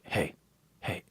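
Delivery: hushed call across the desk, barely voiced; whispered hey. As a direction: sneak a whisper past the teacher. Classmate hissing during class.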